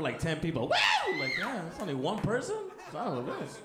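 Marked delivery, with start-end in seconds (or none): 0.6-1.2 high-pitched crowd "woo"; imitated scream. Comic imitating one audience member's loud scream, high whoop. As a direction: mimic one overenthusiastic fan whooping. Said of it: "That scream is loud enough".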